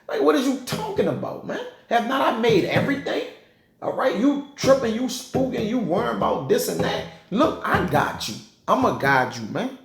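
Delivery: lightly voiced exasperated Lord, brushing off fears; the Lord scolding. Preacher voicing God chiding a worried Moses, high incredulous scold.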